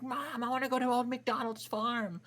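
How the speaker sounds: high-pitched mock tantrum of a little kid; crying child. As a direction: imitate a kid wailing to Mom. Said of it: "I cried because we passed the farm".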